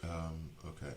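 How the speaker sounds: ordinary talk, nothing marked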